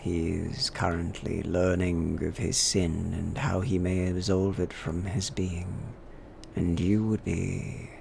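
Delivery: slow, solemn preacher voice; a vicar explaining the sin, slowly. Acted.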